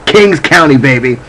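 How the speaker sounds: proud Brooklyn hype; excited shout-out. Real, not acted. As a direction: cheer for your borough. Hometown pride shout-out.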